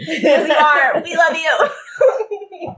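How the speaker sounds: high, loud, stretched and laughing shout; calling out to a hero who might be listening, laughing and loud; joyful call-out. Real, not acted. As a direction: yell your love to her through the mic.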